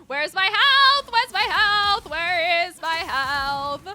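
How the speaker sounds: high, loud frantic yelling; panicked gamer searching for missing health; alarmed shout. Real, not acted. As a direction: yell it in panic.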